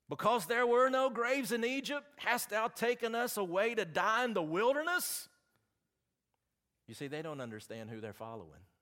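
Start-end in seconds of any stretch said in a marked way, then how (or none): 0.1-5.3 high, loud, desperate outcry; terrified people yelling at their leader; crying out in panic. Acted. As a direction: throw the Israelites' panic at Moses, much higher and louder. Israelites cry out to Moses.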